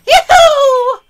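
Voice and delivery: high laughing yoo-hoo; mock sing-song call. Gamer taunts monsters with a high mocking call.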